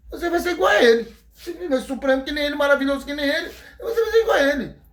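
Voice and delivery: high, fast, laughing serpent voice; the snake sweet-talking with a cackling laugh; tempting serpent. Acted. Serpent tempting Eva, high and giggling.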